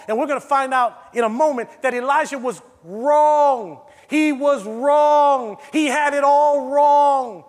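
loud, slowed insistence on wrong; emphatic shout. Real, not acted. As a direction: hammer home that he was wrong. Preacher stressing Elijah was wrong.

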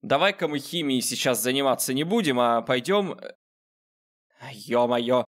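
normal talking voice

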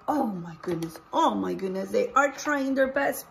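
ordinary talk

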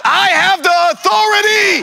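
high, full-voiced shout; shouted declaration. Shown as a demonstration. Preacher leads the congregation to claim authority, shouting. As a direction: shout it out for the crowd to follow. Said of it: "Someone shout".

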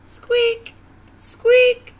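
very high, loud squeak; piercing little squeak; little lion's squeak. Acted. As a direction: call out in a tiny squeaky voice. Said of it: "said Little Lion back to Mouse".

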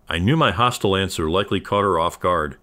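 ordinary delivery

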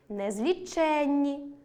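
незліченні with stress on ЧЕН, long double н; stress on the suffix. Shown as a demonstration. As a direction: slowly stretch незлічЕННі. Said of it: "наголос на суфіксі". (наголос на суфіксі: незлічЕнні)

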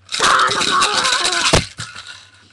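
loud, high burst of laughter; big high cackling outburst. Acted. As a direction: burst out laughing at full volume. Asked why am I laughing.

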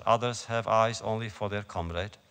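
usual speaking voice

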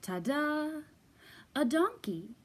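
high showy flourish; ta-da reveal. Acted. Fox showing off the egg to Bear, showy ta-da. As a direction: present it like a magic trick.

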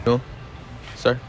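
ordinary talk, nothing marked

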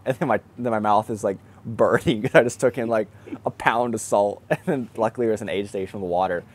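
normal talking voice

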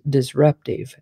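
ordinary delivery

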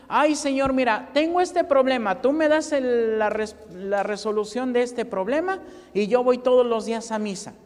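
loud, begging believer striking a deal; pleading prayer. Retold or imitated. Preacher mimicking a believer begging God, loud and pleading. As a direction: plead loudly, like a bargaining churchgoer.

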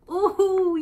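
high, loud ooh of wonder; delighted ooh. Acted. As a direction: gasp with delight. Sudden delight at picture.

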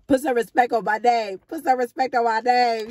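loud, high-pitched crowing; triumphant boasting. Real, not acted. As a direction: crow over the free-drink find, loud and high. Finds a free drink coupon.

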